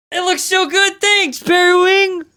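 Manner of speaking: high, loud, stretched-out exclamation; over-the-top delight. Real, not acted. Artist thanking Periwing for chat support, over-the-top delight. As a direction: gush at full volume, dragging the words. Artist cheers a viewer's praise.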